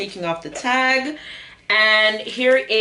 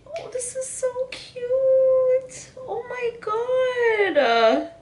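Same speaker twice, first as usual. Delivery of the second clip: delighted gasp; 14 semitones higher, much slower than usual. Real, not acted. Seeing the necklace.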